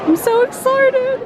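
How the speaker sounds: high, slow thrilled squeal; gushing with real delight; excited. Real, not acted. Excited at the theater.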